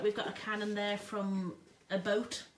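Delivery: ordinary delivery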